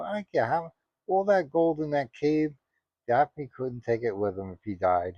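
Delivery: normal talking voice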